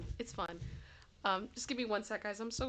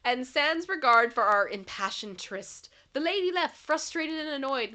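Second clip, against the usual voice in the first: poem reading; 7 semitones higher than usual. Acted. Reads a poem aloud.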